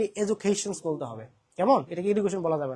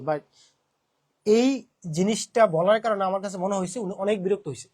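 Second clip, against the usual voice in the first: ordinary talk, nothing marked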